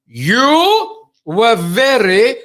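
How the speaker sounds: very slow, loud, over-enunciated; Prince Charles. Retold or imitated. Royal praises a supposed foreigner, mock slow and loud. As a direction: stretch each word as if to a foreigner. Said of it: "he's obviously trying to speak clearly 'cause he thinks I'm a foreigner".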